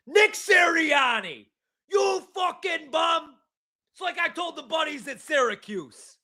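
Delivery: mock furious talk radio fan; angry New York caller.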